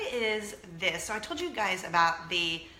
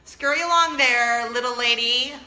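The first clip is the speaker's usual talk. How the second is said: louder, slow shooing tone; mock old lady shooing; imagined dismissal; 3 semitones higher, 11 dB louder than usual. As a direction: wave her off playfully.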